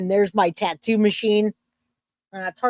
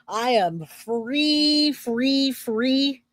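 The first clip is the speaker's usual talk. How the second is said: stretched out; 4 semitones higher, much slower than usual. Acted. Drawn-out celebratory free.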